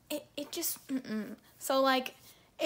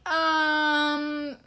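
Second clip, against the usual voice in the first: unsure patient; 4 semitones higher than usual. Retold or imitated. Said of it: "I'm just like".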